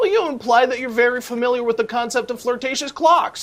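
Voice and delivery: high-pitched, incredulous questioning; alarmed challenge. Acted. Writer challenged about flirtatious clocks, alarmed and high-pitched. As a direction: pounce on the remark, pitch jumping up.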